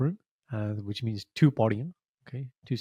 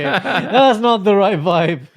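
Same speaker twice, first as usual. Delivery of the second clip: laughing; 8 semitones higher, 10 dB louder, much slower than usual. Real, not acted. Laughing, drawn out.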